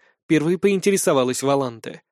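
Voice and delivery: usual speaking voice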